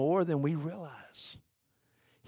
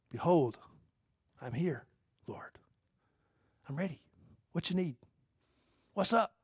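hushed reply; 7 dB quieter than usual. Acted. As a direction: answer sleepily and quietly. Answer to God, hushed.

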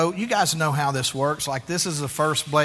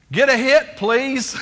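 higher, louder encouraging call; polite coach; 9 semitones higher, 9 dB louder than usual. Retold or imitated. Coach Francis calling to his batter, polite and encouraging. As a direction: cheer the batter on kindly. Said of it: "He says".

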